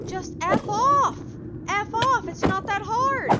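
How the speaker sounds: furious gamer yell; real furious yelling. Real, not acted. Gamer screaming at mobs that keep spawning.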